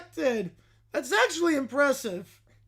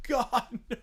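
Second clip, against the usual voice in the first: ordinary delivery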